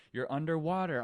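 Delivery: normal talking voice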